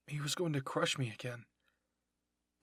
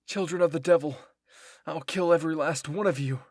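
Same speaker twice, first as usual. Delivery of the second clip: very loud, raised angry threat; furious shout; 3 semitones higher, 12 dB louder than usual. Acted. Bertolt threatens his enemies after being ticked off, furious shout. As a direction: roar it out in full fury. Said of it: "it seemed to tick Bertolt off a lot".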